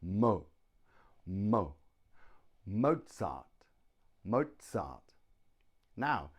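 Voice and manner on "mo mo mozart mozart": Mozart with a strong MO, falling on the second syllable; stress on first syllable. Shown as a demonstration. Teacher demonstrates where stress falls, Mozart weighted on MO. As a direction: punch the MO of Mozart. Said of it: "stress is on the first syllable".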